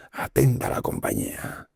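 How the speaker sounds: breathy whisper, drawn out slowly; slow whispered call to attention; whispered command. Retold or imitated. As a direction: hush it like a secret order. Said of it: "y decía esta frase".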